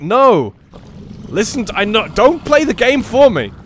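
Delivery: loud, high defiant retort; indignant shout. Retold or imitated. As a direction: shout back at the game, indignant. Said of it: "It's like".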